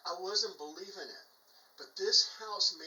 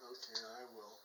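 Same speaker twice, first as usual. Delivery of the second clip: quiet agreement; 6 semitones lower, 11 dB quieter, faster than usual. Retold or imitated. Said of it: "I go".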